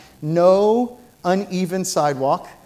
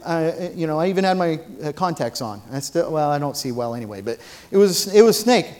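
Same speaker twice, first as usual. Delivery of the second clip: ordinary delivery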